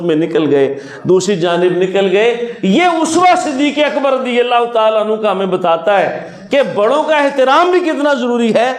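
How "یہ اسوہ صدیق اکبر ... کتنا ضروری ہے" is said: preaching exhortation. Acted. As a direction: press the moral on the listeners. Closing exhortation. (ناصحانہ خطیبانہ انداز)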